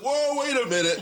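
reacting to the finger-gun gesture; startled whoa. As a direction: pull back in alarmed surprise. Teacher makes gun gesture, retold.

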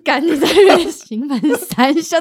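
slow, laughing mock complaint; laughing scold. Real, not acted. Scolding her friend for enjoying her misery, laughing. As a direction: scold him while laughing. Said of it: "friend scolded for enjoying misery".

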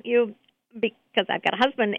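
ordinary delivery

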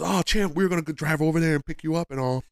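high, quick, eager assistant voice; handler's voice. Retold or imitated. Handler calling after the champ in a high, fast, eager voice. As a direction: chirp it up high and fast like a keen helper. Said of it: "and the guy goes".

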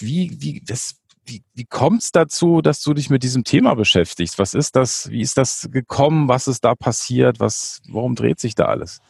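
ordinary delivery

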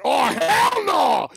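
high, slow, drawn-out imitated shout of refusal; mock outraged shout. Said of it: "And the guy went".

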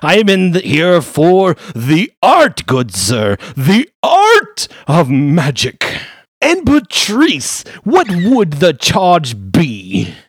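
high, loud, grandly pleased gentleman lawyer; a pompous high-voiced lawyer flattering a client. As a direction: gush like a fussy wizard lawyer in a top hat. Lawyer character continues.

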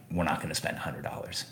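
soft, steady no from mom; kind but firm. Retold or imitated. Mom turning down her pleading son kindly, soft and firm. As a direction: gently shut it down. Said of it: "very kindly, but very firmly saying".

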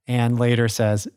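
ordinary delivery

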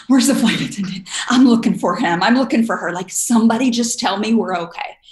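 hammed-up nervous flyer hunting for help; mock panic. Nervous flyer during turbulence searching for the attendant, mock panic. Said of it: "when I start feeling turbulence".

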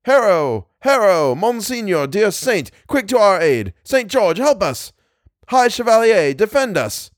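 high, urgent calls for aid; battle cry. A narrator's reading. Phantom bowmen beg Saint George for help, crying out. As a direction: cry out to the saint, pitch well up. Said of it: "the Latin-bred soldier heard the cry".

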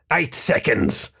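slow, drawn-out rat-man voice; rat countdown. A narrator's reading. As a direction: count down menacingly as the ratty radio foe. Rat man counts down over radio.

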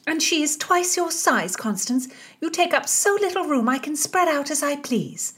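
high, cheeky teasing lady; cheeky friend. A narrator's reading. Mary jokes to Constance about the snoring maid, high. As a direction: tease her right back, high.

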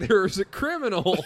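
high, loud shout through laughter; laughing shout. Retold or imitated. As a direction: yell it while cracking up. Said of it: "Bob was like".

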